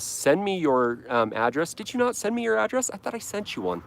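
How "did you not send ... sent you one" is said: surprised question. Real, not acted. Surprised viewer didn't send address.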